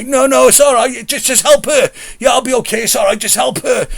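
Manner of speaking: high, loud forced reassurance; pretending all's fine. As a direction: wave off the help while hurt. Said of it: "trying to pretend everything's okay".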